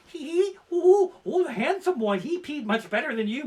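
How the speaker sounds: put-on high, giggly ghost-girl voice.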